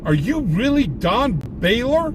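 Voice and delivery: acted-out starstruck disbelief; awestruck kid. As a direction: imitate a kid losing his mind over a star. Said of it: "this kid is losing his mind".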